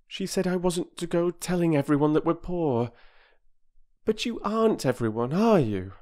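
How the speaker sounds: put-on high, eager young girl's voice; girl Bobby. A narrator's reading. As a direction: plead earnestly as a little girl.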